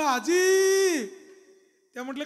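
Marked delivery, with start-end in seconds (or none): 0.0-1.1 very high, long drawn-out yell; alarmed loud shout. Retold or imitated. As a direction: shout out in alarm, stretching the word. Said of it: "मी पुन्हा मोठ्याने ओरडलो". (घाबरून लांबवलेली मोठी हाक)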